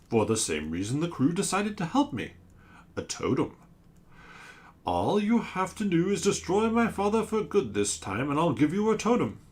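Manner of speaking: a giant monster pleading its case; sea creature voice. Acted. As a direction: sea monster asking the crew for help.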